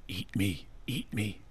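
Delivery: soft, tempting whisper from the fries; creepy whisper. Acted. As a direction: breathe it like temptation calling. Fries calling out to you.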